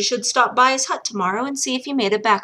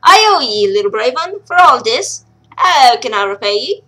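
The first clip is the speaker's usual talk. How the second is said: louder gruff brogue; grateful old dwarf; 2 semitones higher, 7 dB louder than usual. Acted. Miner thanking the girl, gruff brogue. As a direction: thank her heartily.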